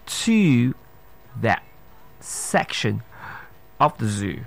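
word by word, with pauses between words; slow drilled sentence. Shown as a demonstration. Teacher drilling learners word by word. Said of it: "Do not dare".

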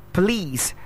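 "Police" as puh-LEECE, reduced first vowel; correct pronunciation. Shown as a demonstration. Teacher modelling P O L I C E, careful pronunciation. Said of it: "and that's police".

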